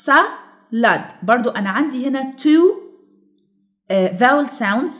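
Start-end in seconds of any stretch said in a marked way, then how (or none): 0.1-1.2 "salad" slowly broken into sa and lad; split into syllables. Shown as a demonstration. Teacher showing two syllables, "salad" syllable by syllable. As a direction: say "salad" with a gap after sa. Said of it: "علشان كده دي بتتكون من two syllables".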